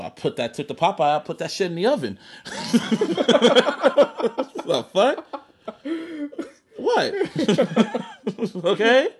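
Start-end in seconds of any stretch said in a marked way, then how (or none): 2.2-4.6 high, loud burst of real laughter; hearty laugh. Real, not acted. Storyteller cracks up at own story. 7.2-8.6 high-pitched fit of giggling; real laughter. Real, not acted. Cracks up after the oven part.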